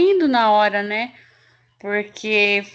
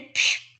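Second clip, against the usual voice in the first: short hissed "psh" of spraying water; water-jet noise; 5 dB quieter than usual. Retold or imitated. Worker telling how the hose hit her leg, imitated spray.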